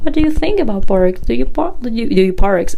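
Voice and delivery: high, quick, fumbling roundabout question; mock someone hinting instead of stating, tripping over the words; beating around bush. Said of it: "beating around the bush".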